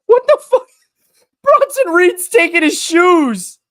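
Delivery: very high, loud hysterical squeal; laughing to tears. Real, not acted. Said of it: "I have tears in my eyes".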